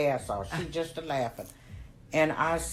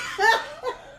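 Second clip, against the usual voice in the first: high breathy laugh. Real, not acted. Laugh alone.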